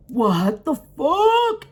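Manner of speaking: long, drawn-out high disbelief; acted shock. Teller looking over at the man, stretched-out shock. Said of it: "and then I'm just like".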